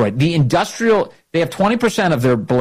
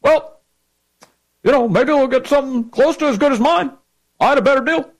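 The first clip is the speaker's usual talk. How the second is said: high, smug mock Obama voice; 11 semitones higher than usual. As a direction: do a whiny, higher Obama impression. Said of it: "It was like".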